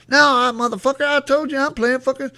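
high-pitched mock defiant kid; cussing kid. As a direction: imitate a child cussing out his coach. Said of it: "cussing this goddamn coach like a grown-ass man".

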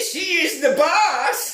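very high, slow silly voice; ridiculous waiter voice. Retold or imitated. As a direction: squeak it out, drawn out and comical. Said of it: "he's got the most ridiculous voice".

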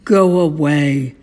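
low, loud grumble; grumpy sleeper. A narrator's reading. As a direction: shoo someone away from under the covers. Grumpy Toad dismisses Frog from bed.

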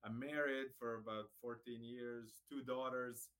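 ordinary talk

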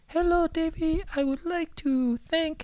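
mock a gentle, quiet man in falsetto. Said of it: "most soft-spoken, quiet guy ever".

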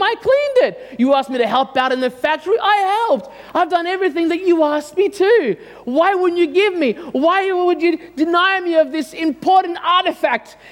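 hammed-up betrayed son, pleading; mock teen rant. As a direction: whine at dad like a wronged teenager. Acts out teenage rant at dad.

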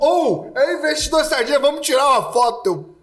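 loud high calling out; excited fan shout. Retold or imitated. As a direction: call out to a celebrity. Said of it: "o povo". (grito animado de fã)

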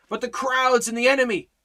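high, loud anxious objection; worked-up follower. Acted. Follower worried by crowds and enemy, high and agitated. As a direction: protest in a fluster. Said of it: "We get all worked up".

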